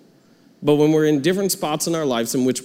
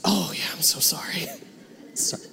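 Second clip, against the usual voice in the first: embarrassed whisper; 3 semitones higher, 10 dB quieter than usual. Retold or imitated. Embarrassed apology at funeral.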